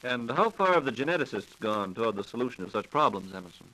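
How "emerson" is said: ordinary talk, nothing marked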